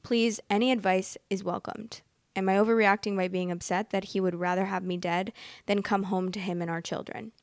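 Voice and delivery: ordinary delivery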